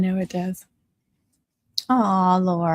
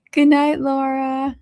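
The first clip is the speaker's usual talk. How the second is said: laughing; 6 semitones higher, slower than usual. Real, not acted. Laughing goodnight to a viewer.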